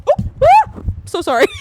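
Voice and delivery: high squeal of a laugh, then a sheepish apology; embarrassed laughing. Real, not acted. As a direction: laugh it off, genuinely flustered. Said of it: "I got too excited".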